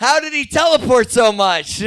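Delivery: confused question through laughter; laughing confusion. Retold or imitated. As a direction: ask it baffled, laughing. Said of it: "you're like".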